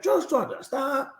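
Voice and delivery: high-pitched, abrupt commanding general; voiced commander. Retold commander halting the drill.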